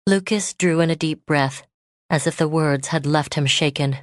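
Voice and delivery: plain reading voice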